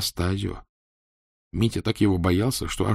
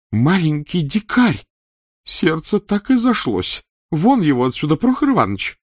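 higher, louder angry empress; 9 semitones higher, 5 dB louder than usual. Acted. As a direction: scold and throw him out. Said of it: "Императрица ужас как рассердилась".

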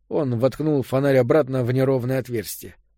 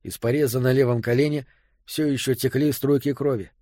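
plain reading voice

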